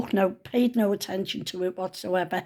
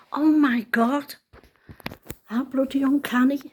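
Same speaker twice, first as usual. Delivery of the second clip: amazed; 6 semitones higher, 4 dB louder than usual. Retold or imitated. Repeats her amazed reaction.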